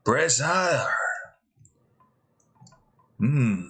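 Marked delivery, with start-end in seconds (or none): 0.1-0.9 higher "Brazier" as brez-EYE-er; brez-EYE-er pronunciation. Shown as a demonstration. Sounding out the word to themself, "Brazier" as brez-EYE-er. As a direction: say "Brazier" with short e, then long i: brez-EYE-er. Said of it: "How the hell do you pronounce that".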